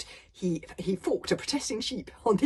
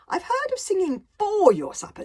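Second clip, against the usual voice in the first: put-on higher eager boy voice; young Hiccup, louder and higher; 13 semitones higher, 10 dB louder than usual. Hiccup voiced in read story.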